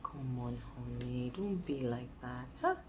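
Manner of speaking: low, softly coaxing sweet talk. Pretend. Woman acting out soothing her angry man, coaxing. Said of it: "your man is angry and you're like".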